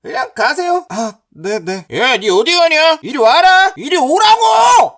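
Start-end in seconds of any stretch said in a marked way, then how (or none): 1.9-5.0 loud shout climbing in pitch; angry summons. Acted. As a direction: order him back, louder and longer each time. Bully calls the fleeing man back. (점점 높아지는 호통)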